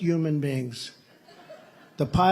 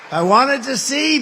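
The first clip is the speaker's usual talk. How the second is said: much higher and louder, slow; 10 semitones higher, 10 dB louder, slower than usual. Real, not acted. Joking to the crowd about awarding himself, loud slow. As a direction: stretch it out loud for the laugh.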